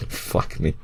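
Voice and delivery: muttered curse. Real, not acted. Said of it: "reader's aside muttered".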